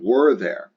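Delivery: emphatic WERE at the head of the question; stress on WERE. Shown as a demonstration. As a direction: hit "Were" hard as the emphatic word. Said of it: "typically is the emphatic word".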